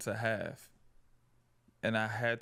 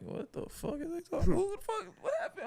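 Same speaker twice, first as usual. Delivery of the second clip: very high-pitched retold disbelief; incredulous. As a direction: squeal it in baffled shock. Retold disbelief at overheard yelling.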